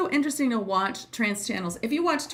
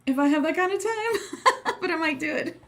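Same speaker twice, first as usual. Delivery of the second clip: laughing; 8 semitones higher than usual. Real, not acted. Laughing at her own plan.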